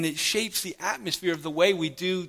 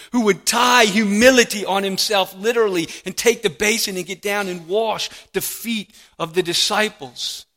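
louder, rising preaching push; fervent preaching; 3 semitones higher, 5 dB louder than usual. Acted. Preacher driving home the picture of the Master, fervently. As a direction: build the image with fervor. Preaching climax at full force.

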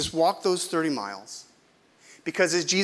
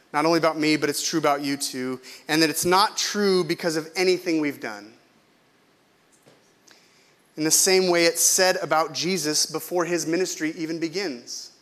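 ordinary talk

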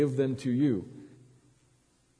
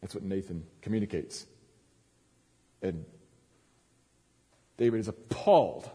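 usual speaking voice

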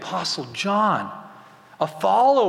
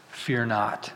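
soft and low, gently spoken; a softer, lower Jesus reassuring Simon; tender; 6 semitones lower, 5 dB quieter than usual. Retold or imitated. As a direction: say it like quiet comfort to a frightened man.

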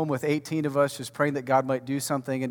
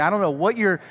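ordinary delivery

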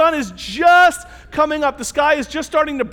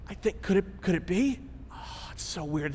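much softer, hushed, lower voice; hushed wonder; 6 semitones lower, 12 dB quieter than usual. Retold or imitated. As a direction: half-whisper it as it dawns on you. Disciples dawning recognition, hushed.

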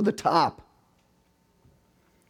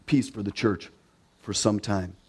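usual speaking voice

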